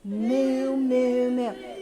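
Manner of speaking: loud drawn-out mooing; cow moo. Shown as a demonstration. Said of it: "what do the cows say?".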